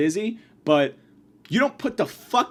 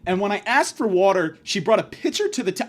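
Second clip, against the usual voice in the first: ordinary talk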